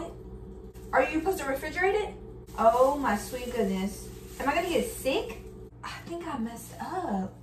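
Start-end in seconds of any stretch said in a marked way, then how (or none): none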